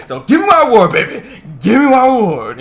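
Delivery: mock triumphant shout; hammed-up demand. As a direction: crow for your prize, playfully over the top. Acted demand for reward.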